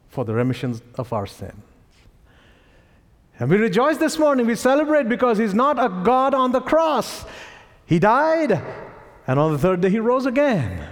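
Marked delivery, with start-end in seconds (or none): 3.4-10.9 high, ringing proclamation from the pulpit; fervent preaching. Real, not acted. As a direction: preach it with rising joy, pitched well up. Preaches at raised pitch.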